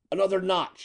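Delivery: high-pitched call without added volume; a contained, high bellow; narrated bellow. A narrator's reading. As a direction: raise the pitch sharply, as if bellowing. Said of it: "he bellowed".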